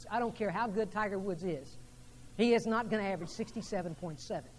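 ordinary talk

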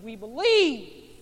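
loud, slow declaration; proclaiming belief. Acted. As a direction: cry out your faith. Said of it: "you and I would have said".

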